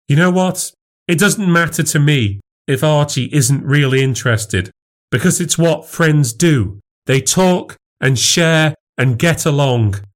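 high, insistent defence; heated arguing. Acted. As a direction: stand up for your friendship. Wife argues back.

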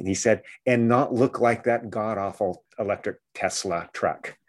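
ordinary talk, nothing marked

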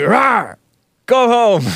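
high-pitched imitation of a warning yell; retold yell, not full force; half-hearted mock yell. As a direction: roar it playfully, holding back. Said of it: "like all soft".

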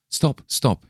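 sudden high call to stop her; high exclaimed stop, stop; exclaiming. A narrator's reading. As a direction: pitch it up to halt the girl. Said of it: "he exclaimed as she turned away".

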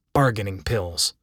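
usual speaking voice

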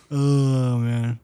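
ordinary delivery

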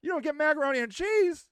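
high, mock-startled question; imitated surprise. The park ranger hearing there's no macaroni, mock surprised. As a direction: act stunned, pitch jumping up. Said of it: "He's like".

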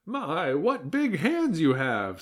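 high, slow, wavering greeting; grandma voice. A narrator's reading. Grandma greets her young visitor, slow granny voice. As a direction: draw it out as an old granny. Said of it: "said the grandma".

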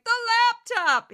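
hammed-up indignant Fox viewer; Fox fanatic imitation. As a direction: blurt it like an obsessed cable news fan. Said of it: "that's my imitation of a Fox fanatic".

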